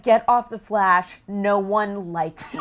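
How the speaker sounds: loud, slow, amused mock insult; mock hate message. Actress reading out a troll's message, mock mean. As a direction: draw out the jeer for comic effect.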